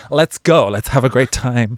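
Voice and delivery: loud, high rallying cheer, imitated; upbeat enthusiasm. Imitating L A people before a show, loudly excited. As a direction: rouse everyone like an eager Californian. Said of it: "in L A people would be like".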